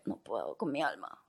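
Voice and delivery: stuffed and exhausted after too much meat; exhausted groan. Retold or imitated. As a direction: groan it out like you're utterly worn out. Exhausted, low and soft.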